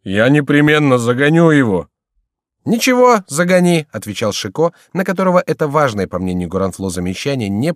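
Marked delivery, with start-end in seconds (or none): 0.0-1.9 higher, louder wail; whining, plaintive insistence; tearful voice. A narrator's reading. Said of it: "говорил Горанфло плачущим голосом". 2.6-3.9 high, loud call back; careless, raised-voice reply; breezy dismissal. A narrator's reading. Chicot callously brushes off the complaint.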